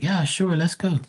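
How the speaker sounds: put-on high, eager agreeing girl. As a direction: play her too keen.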